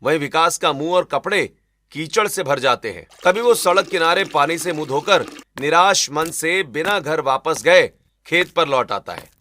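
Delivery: ordinary delivery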